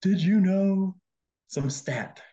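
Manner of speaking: imitating a tearful high question; hammed-up weepy plea; mock crying. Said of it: "crying and being like".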